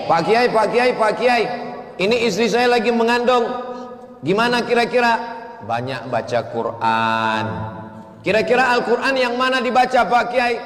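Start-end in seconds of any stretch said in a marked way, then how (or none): 0.1-5.4 eager husband calling. Retold or imitated. 8.2-10.5 eager husband calling. Retold or imitated. Husband asking again.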